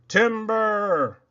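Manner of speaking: long, loud call of a falling tree; lumberjack yell. Acted. Paul Bunyan hollers as his tree falls, loud drawn-out yell. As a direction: holler it across the woods.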